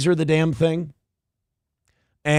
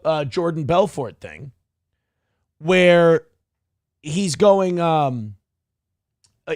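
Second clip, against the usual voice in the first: usual speaking voice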